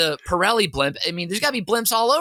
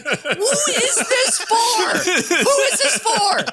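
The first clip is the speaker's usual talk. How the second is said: high, slow, laughing and louder; laughing disbelief; 4 dB louder, slower than usual. Retold or imitated. Viewer bewildered by the broadcast, laughing high. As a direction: cry out in amused bewilderment. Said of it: "You're like".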